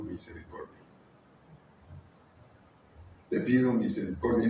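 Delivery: soft, low, humble plea to God; a quiet retold plea for mercy; humble prayer. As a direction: pray it quietly, almost broken.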